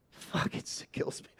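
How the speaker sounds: breathless laughing curse. Real, not acted. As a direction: laugh through the words.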